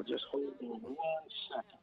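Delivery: ordinary delivery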